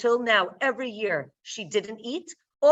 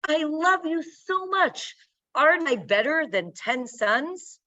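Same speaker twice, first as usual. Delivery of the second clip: raised, sweet reassuring tone, slightly hammed; mock tender husband; 6 semitones higher than usual. Elkanah reassuring his barren wife Hannah, mock tender. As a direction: lay on the loving husband bit.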